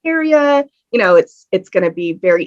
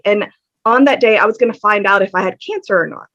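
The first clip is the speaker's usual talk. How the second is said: usual speaking voice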